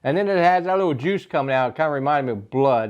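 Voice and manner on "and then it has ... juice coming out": ordinary delivery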